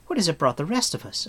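put-on high, quick girl's retort; Eilonwy, indignant. A narrator's reading.

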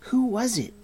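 high, insistent question; demanding. A narrator's reading. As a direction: demand to know who. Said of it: "Taran demanded".

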